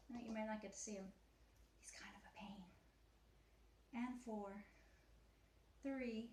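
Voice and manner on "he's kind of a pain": soft whispered aside about the cat. Real, not acted. Instructor whispers a joke about her cat to viewers.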